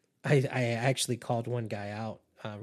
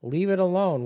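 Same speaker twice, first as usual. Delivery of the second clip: much higher and louder, near shouting; exasperated yell; 8 semitones higher, 8 dB louder than usual. Retold or imitated. Manipulator fed up with the complainer, shouting. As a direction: really yell it to end the topic. Escalating dismissive repeat.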